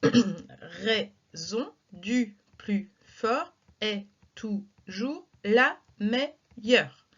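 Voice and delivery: very slow, each syllable detached and counted; slow scansion, one beat per syllable. Shown as a demonstration. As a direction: tap out every syllable slowly. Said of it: "en tapant dans les mains par exemple".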